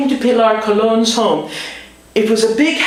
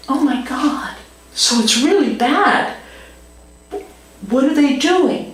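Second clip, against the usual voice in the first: higher, alarmed disbelief; shocked; 7 semitones higher than usual. Retold or imitated. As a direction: react to bad news with alarm. Shocked at mosquito facts.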